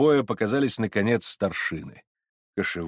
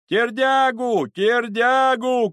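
very high, slow, loud chant; drawn-out, high-pitched roar; crowd shout; 13 semitones higher, 6 dB louder, much slower than usual. Acted. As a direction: bellow the name with the mob. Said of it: "кричала толпа".

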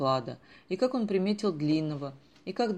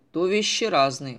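self-important; 8 dB louder than usual. A narrator's reading. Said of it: "важно отвечал Сеня".

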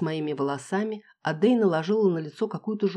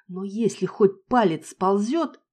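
slow threat; 4 semitones higher, 5 dB louder, slower than usual. Acted. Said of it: "пригрозила".

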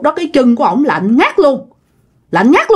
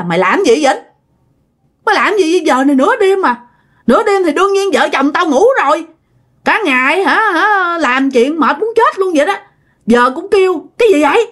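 higher-pitched irritated scolding; fed-up annoyance; 7 semitones higher than usual. Retold or imitated. Dad woken at midnight scolding his friend, annoyed. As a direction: imitate a husband woken and exasperated. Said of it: "ba cũng bực quá rồi".

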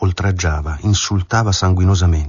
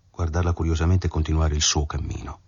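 ordinary delivery

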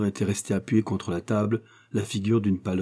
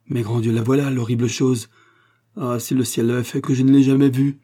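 louder, rising cry of dread; horrified; 4 semitones higher, 6 dB louder than usual. A narrator's reading. Schlesinger catching sight of the dreadful hatchet, horrified. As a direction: recoil in horror as you speak. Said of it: "saisi d'un redoublement d'épouvante".

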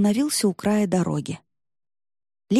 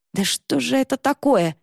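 higher-pitched indignant exclamation; возмущение; 6 semitones higher than usual. A narrator's reading. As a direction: protest with mild outrage. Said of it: "возмутилась Варя". (возмущённо, на повышенном тоне)

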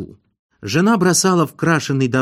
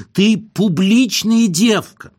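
high, slow, declamatory whine; drawling whine; 8 semitones higher, 3 dB louder, slower than usual. A narrator's reading. Husband abusing his unfaithful wife, in a drawling whine. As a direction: wail the insult with stretched vowels. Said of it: "тягуче заныл он".

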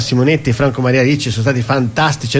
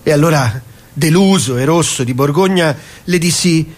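poem recital. Acted. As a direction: recite it like a grand verse. Reading a comic wine poem. (lettura lenta e solenne di una poesia)

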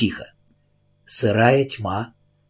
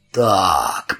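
ironic; 5 semitones lower than usual. A narrator's reading. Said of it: "произнёс полицейский ироническим тоном".

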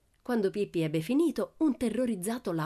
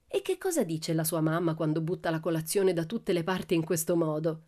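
ordinary delivery, nothing marked

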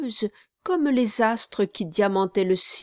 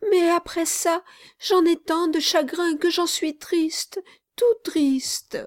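put-on higher child's voice, sorrowful; a little boy insisting through grief; high, sad child's voice; small child; 8 semitones higher than usual.

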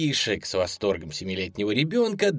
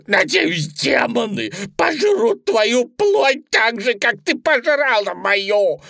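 vengeful, melodramatic wail; cursing rabbit; 9 semitones higher, 5 dB louder than usual. A narrator's reading. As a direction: thunder a dying curse in bunny voice. Bunny's dying curse.

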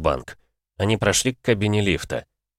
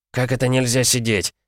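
higher, mildly indignant question; incredulous question, pitched up; indignant challenge; 6 semitones higher than usual. A narrator's reading. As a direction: throw the refusal back in disbelief. Challenges the clerk's refusal.

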